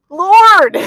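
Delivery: loud, high drawn-out wail; hammed-up exasperated shout; exasperated cry to God.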